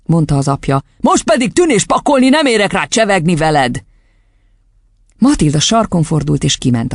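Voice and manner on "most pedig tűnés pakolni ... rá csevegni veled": raised, irritable dad voice; impatient fatherly order, falling away; dismissive father. A narrator's reading. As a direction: send the child off crossly. Father sends girl away.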